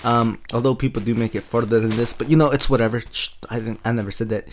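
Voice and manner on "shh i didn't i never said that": hushed. Acted. Hushing an aside to viewers.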